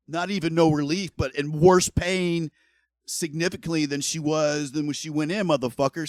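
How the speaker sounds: heated outrage over his wife's pain; angry rant. Real, not acted. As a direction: vent it hard at the doctors. Ranting about wife's worse pain.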